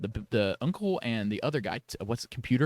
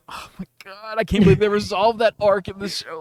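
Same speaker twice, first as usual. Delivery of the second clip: higher, delighted disbelief; excited gushing; 8 semitones higher, 6 dB louder than usual. Real, not acted. Host thrilled the show resolved the arc, excited. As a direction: gush like a thrilled fan.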